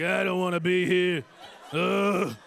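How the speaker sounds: mock grumbling reluctance, with groans; reluctant. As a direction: groan it like an unwilling therapy patient. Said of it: "They're, like, reluctant".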